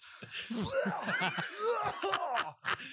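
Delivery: high shrieking laughter. Retold or imitated. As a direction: burst into a shrill laugh. Retelling friend's outburst.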